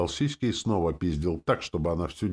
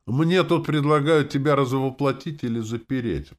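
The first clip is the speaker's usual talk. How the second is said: ordinary delivery, nothing marked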